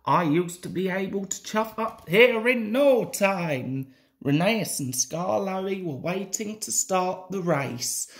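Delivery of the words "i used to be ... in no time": ordinary delivery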